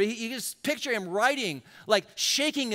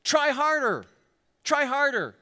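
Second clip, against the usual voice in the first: pushy taskmaster; 4 semitones higher than usual. Pretend. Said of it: "Religion says".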